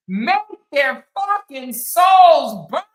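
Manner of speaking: slow, loud, defiant shout; fierce shout. Real, not acted. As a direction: hype her up, belting it out slowly. Hyping Cora against haters.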